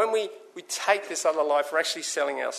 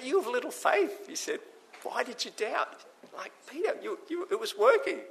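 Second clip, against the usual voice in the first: gentle rebuke; 8 semitones higher, 5 dB quieter than usual. Acted. Jesus gently rebuking Peter.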